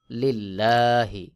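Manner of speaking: লিল্লাহি slowly, with a thin, light lam; লিল্লাহি, লাম পাতলা করে. Shown as a demonstration. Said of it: "বারিক বা পাতলা করে পড়া".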